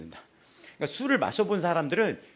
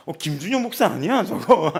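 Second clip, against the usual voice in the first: mock recognition; 4 semitones higher, 8 dB louder than usual. Passerby spots the pastor.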